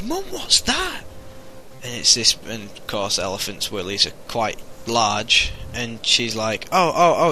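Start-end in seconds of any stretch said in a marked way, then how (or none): 0.0-1.0 put-on high, slow child's voice; curious little boy. Little boy asks his mum at the zoo, high curious child voice. 6.7-7.3 higher, louder mock-flustered stammer; flustered mum. Mum dodges her son's question at the zoo, flustered.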